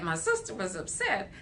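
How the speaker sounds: usual speaking voice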